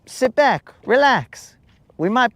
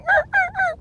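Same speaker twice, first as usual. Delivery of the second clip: high mock meowing like a cat; squeaky mock kitten meow. Imitating cat meows.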